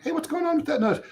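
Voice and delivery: high, fast, pushy check-in; impatient nagging. Retold or imitated. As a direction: hurry him up, a bit exasperated. Pestering lawyer about the notice.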